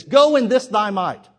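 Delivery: loud, slow divine command; slow, loud preached command; booming voice of God. Acted. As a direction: proclaim it slowly again from the pulpit. God's command repeated.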